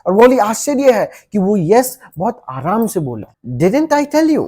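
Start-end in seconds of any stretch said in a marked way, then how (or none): 3.6-4.4 delighted. Acted. Teacher triumphant.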